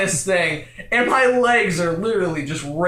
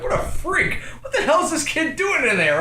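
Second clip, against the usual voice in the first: imitated appalled parents; 7 semitones higher than usual. Voices the baffled parents.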